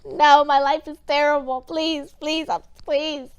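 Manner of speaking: hammed-up, high-pitched begging; exaggerated desperate plea with loud bursts; mock pleading. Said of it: "obviously pleading for his life".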